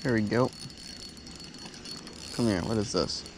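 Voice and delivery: normal talking voice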